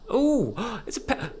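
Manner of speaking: high cooing gasp; high-pitched, breathless "ooh"; delighted surprise. Real, not acted. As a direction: gasp and coo at a cute discovery. Spots a cute penguin monster.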